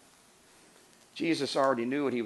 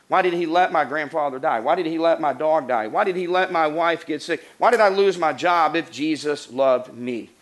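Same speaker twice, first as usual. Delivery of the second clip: louder, driving chain of anguished questions; preaching at full force; 2 semitones higher, 8 dB louder than usual. Acted. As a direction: hammer each "why" like a wounded believer. Voices anguished complaints at full force.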